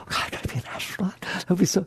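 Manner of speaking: fast, whispered gush of excitement; mock-excited hushed gush; breathless whisper. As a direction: whisper it like a giddy kid. Voicing giddy impulse.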